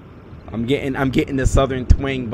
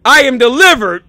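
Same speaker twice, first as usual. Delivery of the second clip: hyped quote; 13 semitones higher, 14 dB louder than usual. Retold or imitated. Quoting teammate while hyped.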